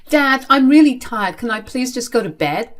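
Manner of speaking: high-pitched appeal to be let off; pleading. Acted. Tired child asking father for bed, pleading. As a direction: plead to end the argument.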